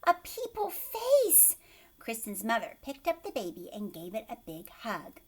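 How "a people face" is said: high, loud laughing cry, slow; laughing delight. Acted. Mother seeing her baby's face, high laughing delight. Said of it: "and said".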